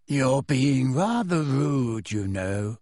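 slow, dreamy, airy reproof; unhurried, placid voice, sinking at the end; serene. A narrator's reading. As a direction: drift serenely through the words. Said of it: "said Luna serenely".

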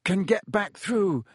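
high, halting, strained voice; falter, then push on while holding it together; worried, hesitant calling; trying to sound steady. A narrator's reading. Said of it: "trying to keep his voice steady".

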